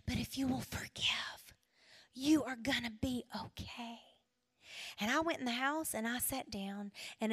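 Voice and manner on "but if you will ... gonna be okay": soft, reassuring whispered voice; gentle whisper. Retold or imitated. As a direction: whisper comfort to a hurting heart. God's gentle voice to her.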